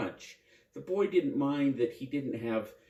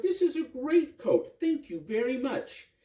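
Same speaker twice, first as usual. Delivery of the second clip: much higher, bright and happy; a child thrilled with a coat; high excited boy voice; boy's delight; 12 semitones higher than usual. Acted.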